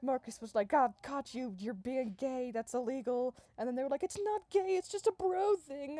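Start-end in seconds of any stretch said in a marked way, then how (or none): 0.7-3.3 loud, raised-pitch mock bust; hammed-up outraged accusation; acted accusation. Robot agent busts the boys. 4.0-6.0 very high, loud defensive squeal; hammed-up frantic denial; mock indignant protest. Boys protest the accusation.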